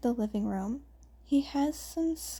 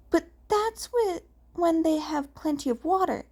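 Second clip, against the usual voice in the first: put-on lightly higher young twin, quick; a boy pointing out a flaw, hurried; a touch of a higher boy's voice; character voice; 5 semitones higher, 4 dB louder than usual. A narrator's reading.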